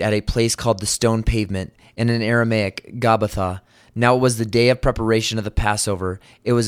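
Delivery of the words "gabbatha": normal talking voice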